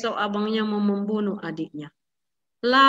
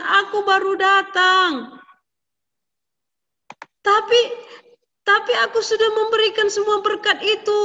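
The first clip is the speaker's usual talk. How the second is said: high, loud flustered pleading; distressed old father; 10 semitones higher, 5 dB louder than usual. A narrator's reading. Father telling Esau the blessing is gone, high and upset. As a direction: panic creeping in as the truth dawns.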